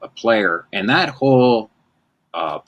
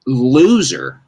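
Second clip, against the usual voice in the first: mock yell; 5 semitones higher, 3 dB louder, slower than usual. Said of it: "but I would go".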